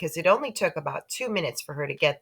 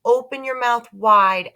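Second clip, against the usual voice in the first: put-on boy instructing; 7 semitones higher, 7 dB louder than usual. A narrator's reading. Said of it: "I said".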